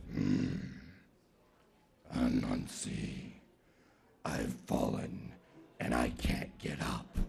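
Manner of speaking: very low, soft, slow rasp; trapped leopard. Acted. Leopard pleads from the pit, deep and feeble. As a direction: feeble deep big-cat plea.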